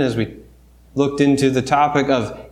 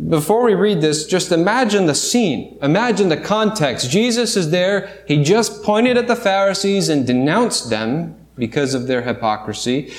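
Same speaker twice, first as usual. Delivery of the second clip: usual speaking voice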